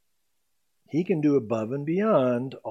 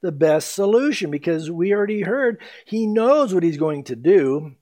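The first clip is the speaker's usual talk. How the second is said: ordinary delivery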